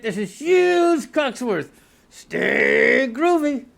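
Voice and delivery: slow, high drawn-out sign-off; host signing off slowly; announcer sign-off. Acted. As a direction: stretch each word like a cheesy host.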